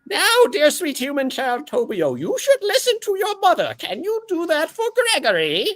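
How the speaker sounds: put-on gentle orb to a child. Gregory the orb talking sweetly to little Tobio. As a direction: coo kindly to a small boy.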